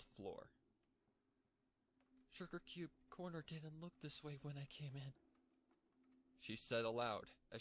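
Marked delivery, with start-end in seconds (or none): 2.3-5.1 high, bubbly pony voice, puzzled; Pinkie Pie voice. Acted. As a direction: play the cartoon pony noticing something.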